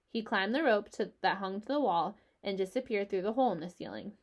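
ordinary delivery

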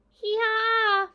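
high, loud braying animal cry; bleating chorus. Acted. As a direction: bray it all together like a flock. Sheep answer all together.

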